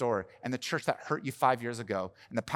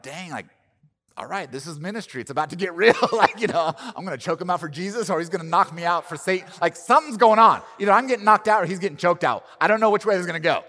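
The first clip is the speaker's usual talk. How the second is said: higher and louder, building to near shouting; hyped-up retelling; 7 semitones higher, 11 dB louder than usual. Retold or imitated. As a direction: relive the moment of a fight about to break out, rising and rushing. Retold thoughts before a fight.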